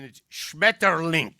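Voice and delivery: Schmetterling barked loud and slow; harsh German word. Shown as a demonstration. Comedian mocking German words, loud slow Schmetterling. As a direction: spit out Schmetterling harsh and heavy. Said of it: "take the word butterfly".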